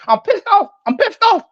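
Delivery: a high comic yelp of annoyance; Bernie Mac impression. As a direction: squeal out the comedian's fed-up complaint. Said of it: "like Bernie Mac said".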